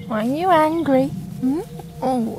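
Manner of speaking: ordinary talk, nothing marked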